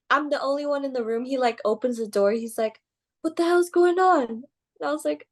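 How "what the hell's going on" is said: imitated angry demand, high and loud; Booker. Boss opening the door on his trainee, mock anger. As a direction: retell an outraged boss bursting in. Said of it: "he was just pissed".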